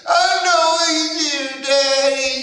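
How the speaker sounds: put-on be the small girl insisting; little girl voice. Daughter answering her dad at night, high childlike insistence. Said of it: "She said".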